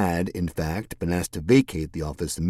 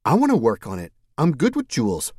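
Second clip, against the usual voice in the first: put-on eager young woman; 7 semitones higher, 4 dB louder than usual. Mercedes voice.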